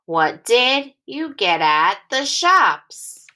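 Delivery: "What did you get at the shops?" slow, in smooth phrases, falling on "shops"; expressive read-aloud with a fall on "shops"; phrased with expression. Shown as a demonstration. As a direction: read it in scooped phrases, not like a robot. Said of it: "scoop into phrases and say it again together with expression".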